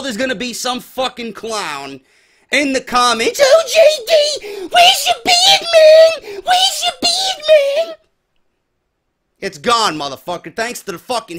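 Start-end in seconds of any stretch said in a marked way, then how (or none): 3.4-7.9 very high whiny mocking goon voice. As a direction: squeal it like a dim clown commenter. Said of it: "goon voice alert".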